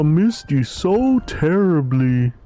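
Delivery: high, swooping melodrama; theatrical character voice. Acted. He greets a friend he thought had died, high melodramatic relief. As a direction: lament it in a high, sing-song character voice. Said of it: "I remember this guy's voice".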